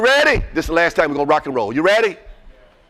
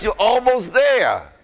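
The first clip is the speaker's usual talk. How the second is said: slow urging; 6 semitones higher, much slower than usual. Real, not acted. Speaker urging audience louder, slowly.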